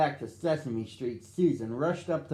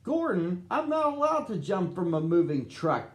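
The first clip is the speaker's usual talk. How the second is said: yelled back; 5 semitones higher than usual. A narrator's reading. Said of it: "Big Bird yelled back".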